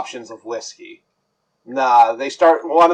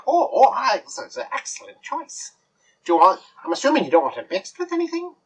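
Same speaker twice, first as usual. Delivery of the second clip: high, much softer fairy voice, partly whispered; fussy fairy bartender; 8 semitones higher, 3 dB quieter than usual. Acted. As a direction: lean in and breathe it like a conspiring fairy. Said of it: "he's like".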